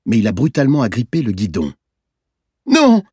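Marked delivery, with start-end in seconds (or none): 2.7-3.0 high, loud tearful cry; sobbed protest. A narrator's reading. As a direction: cry out as a sobbing child. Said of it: "il a sangloté". (cri aigu d'enfant qui sanglote)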